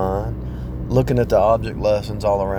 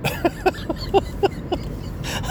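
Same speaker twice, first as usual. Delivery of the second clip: high breathless laugh. Real, not acted.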